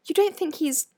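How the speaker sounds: mildly uneasy, hesitant question. A narrator's reading. Hermione asking friends about missing Hagrid, uneasy. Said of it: "said Hermione uneasily".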